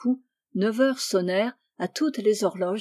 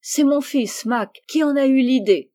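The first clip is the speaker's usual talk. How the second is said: higher, louder, happy reveal; 5 semitones higher, 5 dB louder than usual. A narrator's reading. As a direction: reveal it with delight. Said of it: "presque aussi heureux qu'elle". (révélation joyeuse, voix haute)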